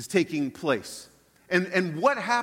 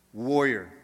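low, grave echoed word; dramatic echo; 5 semitones lower than usual. Acted. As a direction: repeat it slowly for effect. Echoes title after pause.

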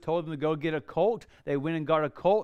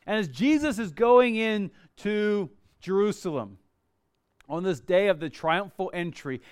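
ordinary talk

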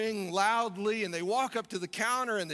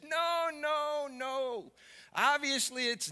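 higher, insistent comic contradiction; mock exasperated wife; 6 semitones higher, 3 dB louder, slower than usual. Wife correcting her husband in the car, exasperated. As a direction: argue back in a mock huff, dragging out the place name.

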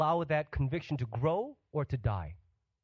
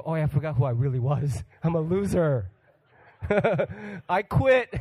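laughing mock defeat; 5 dB louder than usual. Preacher voicing a quitter on January third, laughing mock defeat. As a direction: laugh as you give up the resolutions.

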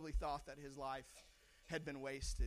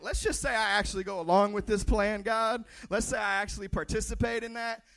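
exasperated Moses; 3 semitones higher, 10 dB louder than usual. Pretend. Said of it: "He's like".

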